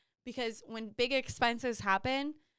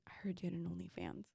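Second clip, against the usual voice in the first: quick whispered gossip, much softer; secretive whisper; 6 semitones lower, 15 dB quieter, faster than usual. Retold or imitated. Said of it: "He's like".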